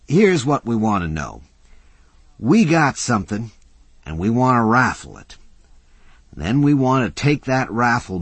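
lightly voiced tough guy; rough working man. Mac voiced by narrator.

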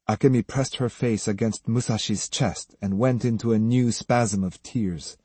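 plain reading voice

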